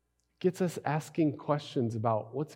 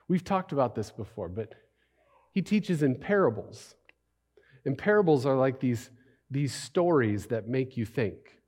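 ordinary talk

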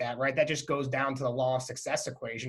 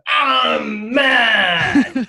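high, loud mock toddler yell; angry toddler shout; 8 semitones higher, 11 dB louder than usual. Toddler son denied a waffle, shouting that he's mad. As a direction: shout it like a furious two-year-old. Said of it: "He goes".

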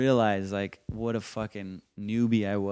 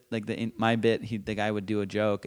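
ordinary talk

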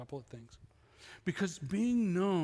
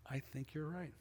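much softer, grudging concession; inner admission; 3 semitones lower, 11 dB quieter than usual. Retold or imitated. Admitting to himself a friend was right about his marriage, barely audible. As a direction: say it under your breath, to yourself. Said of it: "I just said it inside".

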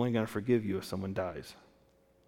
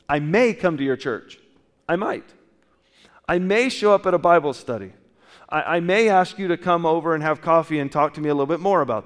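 louder and higher, pressing the point; rising appeal; 6 semitones higher, 8 dB louder than usual. Real, not acted. Preacher urging church to welcome seekers, loudly. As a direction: push it out to the back row.

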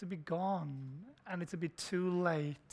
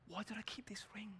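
a broken, whispered question; weeping whisper; 2 semitones higher, 15 dB quieter than usual. Retold or imitated. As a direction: whisper it through tears. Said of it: "he's weeping".

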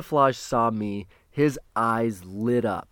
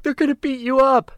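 high-pitched, louder mock alarm; alarmed warning; 14 semitones higher, 5 dB louder than usual. Wife warns husband the crowd is after him, mock alarm. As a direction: imitate a worried wife, voice shooting high.

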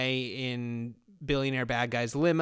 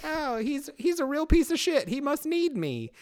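proud dog voice; 12 semitones higher than usual. Retold or imitated. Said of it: "Butterball is like".

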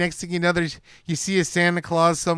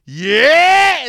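high, loud, stretched yeah; hammed-up imitated shout; mock villain yell; 10 semitones higher, 10 dB louder than usual. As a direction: holler it like the movie villain. Re-enacting the villain's cry.

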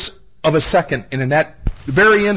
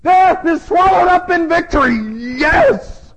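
much higher, louder triumphant proclamation; archangel's exclamation; 13 semitones higher, 6 dB louder than usual. Acted. Preacher voicing the archangel to the congregation, high ringing triumphant exclaim. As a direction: cry it out like an archangel announcing victory.

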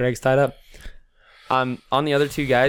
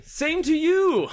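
high, drawn-out jovial cry; hammed-up character greeting; much slower than usual. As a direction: stretch it out high with a laugh. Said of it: "He's gonna say".

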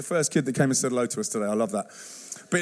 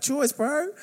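high Kiwi imitation slipping into Aussie; badly faked New Zealand accent, rising at the end; 9 semitones higher than usual. Mimicking everyone quoting TV slang, botched Kiwi accent. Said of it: "That was Aussie. That was bad, wasn't it?".

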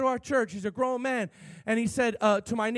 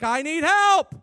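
loud, high mock plea for help; retold desperate cry; 9 semitones higher, 10 dB louder, slower than usual. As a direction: cry out, imitating a desperate man. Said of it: "and he's like".

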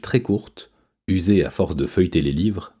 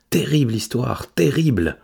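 high-pitched dramatic lament; high, aghast exclamation; dramatic dismay; 6 semitones higher than usual. A narrator's reading. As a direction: exclaim in theatrical dismay, voice lifted. Cat reacting to sad story.

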